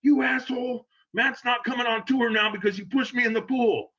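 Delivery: mock angry outburst. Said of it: "I go".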